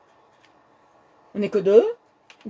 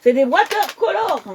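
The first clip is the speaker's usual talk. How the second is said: excited; 9 semitones higher, 4 dB louder than usual. Real, not acted. Shows off the new pens.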